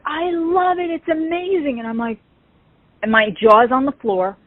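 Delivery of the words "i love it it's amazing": high sweet imitation of the singer; gushing singer. The singer delighting in her fan's costume, high and sweet. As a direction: gush up high like a delighted star. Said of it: "and she's like".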